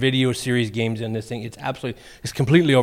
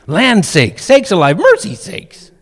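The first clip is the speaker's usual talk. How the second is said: rising into a high squeak on "mercy sakes"; mock old lady fuss, climbing higher; hammed-up old-timer voice; old-timer exclamations; 6 semitones higher, 7 dB louder, slower than usual. Reciting old folks' exclamations.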